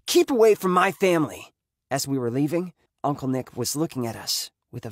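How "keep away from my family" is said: high, loud, threatening father; raised-voice angry order; angry warning. A narrator's reading. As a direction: warn him off forcefully. Angry father warns uncle off.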